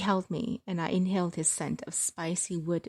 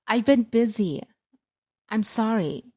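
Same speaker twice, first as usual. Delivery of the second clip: louder, higher defensive reply; defensive daughter; 4 semitones higher, 5 dB louder than usual. Acted. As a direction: push back at mom, raised voice.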